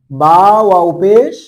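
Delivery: বা ওয়াও পেশ said very slowly and loud, with the পেশ vowel stretched long; drawn-out vowel. Shown as a demonstration. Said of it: "পেশকে লম্বা করলে ওয়াও উচ্চারণটা বেরোচ্ছে না". (ধীরে ও জোরে, পেশ টেনে লম্বা করে)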